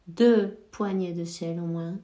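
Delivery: ordinary talk, nothing marked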